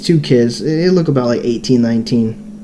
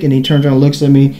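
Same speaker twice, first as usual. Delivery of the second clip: ordinary talk, nothing marked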